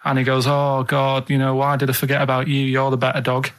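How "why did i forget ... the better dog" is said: ordinary talk, nothing marked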